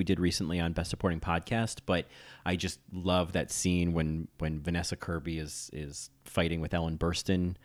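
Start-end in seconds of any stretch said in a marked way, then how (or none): none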